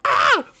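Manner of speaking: short, high, loud mock scream; outraged scream. Teller recalls kid's insult, short hammed-up scream. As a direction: let out a comic shriek of outrage.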